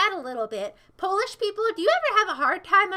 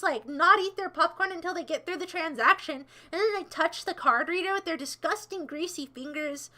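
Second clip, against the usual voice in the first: ordinary delivery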